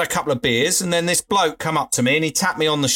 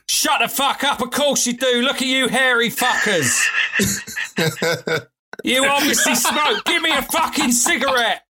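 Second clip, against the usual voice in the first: imitating an angry bloke; 9 semitones higher than usual. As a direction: rant at them as if they're lying to you. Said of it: "he was really angry and annoyed".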